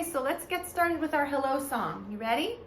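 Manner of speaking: usual speaking voice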